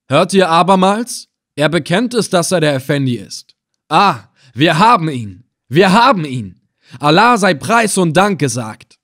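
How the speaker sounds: loud, high, exultant cries; triumphant announcement. A narrator's reading. Captor tells his men the Effendi is caught, triumphant announcement. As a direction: rejoice over the capture.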